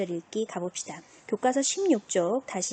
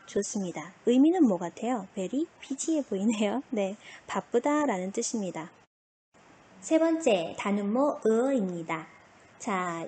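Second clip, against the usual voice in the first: ordinary talk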